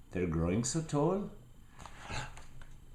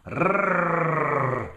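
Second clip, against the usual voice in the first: loud higher growling roar; monster roar; 7 semitones higher, 6 dB louder than usual. Acted. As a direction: roar at Max like a wild thing. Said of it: "roared their terrible roars".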